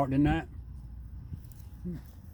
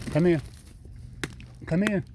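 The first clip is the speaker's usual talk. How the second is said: high sing-song call to a pet; coaxing call; 5 semitones higher, 4 dB quieter than usual. Real, not acted. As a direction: call the animal over sweetly. Calling raccoon over.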